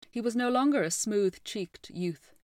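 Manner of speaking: ordinary delivery, nothing marked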